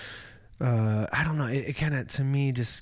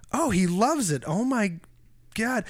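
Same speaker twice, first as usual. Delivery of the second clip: excited; 9 semitones higher than usual. Retold or imitated. Retelling his own excited reaction.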